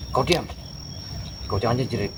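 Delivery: normal talking voice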